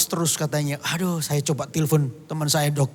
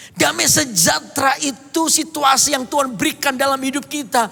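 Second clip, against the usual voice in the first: high-pitched, driving sermon voice; fervent preaching; 9 semitones higher, 4 dB louder than usual. Acted. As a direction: preach it to the congregation with fire. Preaching at full force.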